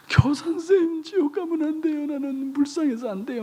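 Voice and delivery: put-on high, wailing child's plea; imitated tearful child's plea; mock crying child. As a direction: whimper it like a sobbing child. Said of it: "막 우는 거야".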